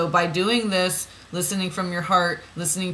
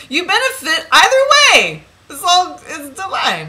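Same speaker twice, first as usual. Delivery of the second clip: laughing; 11 semitones higher, 12 dB louder than usual. Real, not acted. Gleeful high laughing exclamation.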